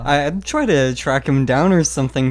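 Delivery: normal talking voice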